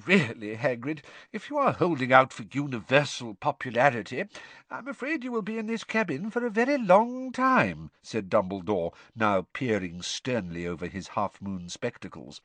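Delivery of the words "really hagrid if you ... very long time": lightly voiced calm old headmaster; a hint of gentle, wry old wizard; Dumbledore's voice.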